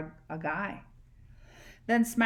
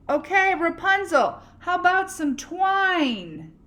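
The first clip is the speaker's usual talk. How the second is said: calling up; 9 semitones higher, 6 dB louder than usual. Acted. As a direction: shout the request up again. Prince calls up again.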